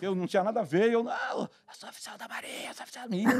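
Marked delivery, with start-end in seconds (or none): 1.0-3.4 faint hoarse croak with no voice left; voiceless rasp. Retold or imitated. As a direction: try to shout but only air comes out. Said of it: "não saía nada". (sem voz, rouco, quase sem som)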